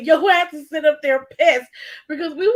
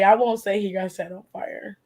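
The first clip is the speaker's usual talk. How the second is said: ordinary delivery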